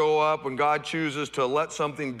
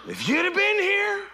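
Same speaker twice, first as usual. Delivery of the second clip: much higher, pleading cry of reproach; Martha's reproach; 5 dB louder than usual. Retold or imitated. Martha reproaching Jesus, high pleading cry. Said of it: "Martha said to Jesus".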